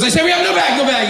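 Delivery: loud, high mock shout of the vendor; shouting newsstand guy. Newsstand guy shouting at the comic to go. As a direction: bark it like the angry vendor. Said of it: "He goes".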